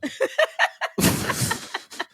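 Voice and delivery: airy high-pitched burst of laughter; high wheezing laugh. Real, not acted. Podcast host laughing at his own gym-crush story, wheezing high laugh. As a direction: crack up at your own confession.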